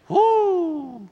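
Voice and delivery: long, high falling "woo"; exaggerated hoot of alarm; high whoop. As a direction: let out a drawn-out mock-shocked whoo. Mock shock at moving to Haiti.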